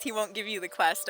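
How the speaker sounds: ordinary delivery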